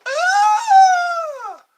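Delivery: high, loud imitated child's wail; mock kid scream. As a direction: shriek like a hurt child. Kid cries after getting hurt.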